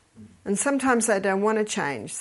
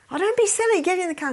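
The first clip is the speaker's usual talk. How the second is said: high, loud mock impatience; exasperated husband; 10 semitones higher, 4 dB louder, faster than usual. Husband ordering wife into the car, exasperated. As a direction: dismiss her impatiently, pitched well up.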